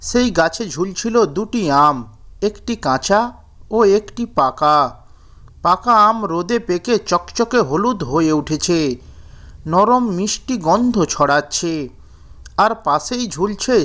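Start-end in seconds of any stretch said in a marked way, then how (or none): none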